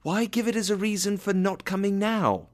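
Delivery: high, insistent question; pleading protest. A narrator's reading. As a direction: appeal to him in a sustained high pitch. Nephew protests the refusal.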